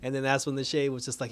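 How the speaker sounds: normal talking voice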